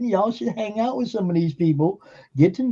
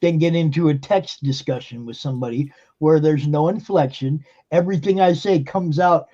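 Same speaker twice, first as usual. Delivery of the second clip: ordinary delivery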